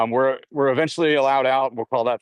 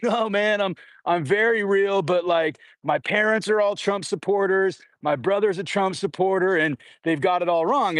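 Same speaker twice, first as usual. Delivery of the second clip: higher, pressing insistence; earnest eager insistence; 7 semitones higher than usual. Retold or imitated. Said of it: "And he's like".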